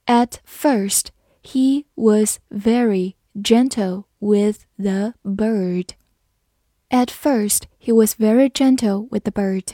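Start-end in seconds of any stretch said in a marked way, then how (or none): 0.1-5.9 slow, word-by-word model reading; slow read-through. Shown as a demonstration. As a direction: read it slowly with small gaps between words. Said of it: "慢读一遍". (一词一顿地慢读)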